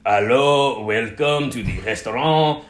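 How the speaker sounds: a hint of a hammed-up French waiter greeting; fake French waiter. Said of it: "he was definitely playing it up a lot".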